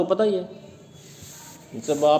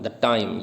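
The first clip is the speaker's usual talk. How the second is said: "the time" as one pair, stress on time; light "the", strong "time"; iambic foot; 6 semitones lower than usual. Shown as a demonstration. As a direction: set "the time" apart as a da-DUM beat. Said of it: "ये पांचवा".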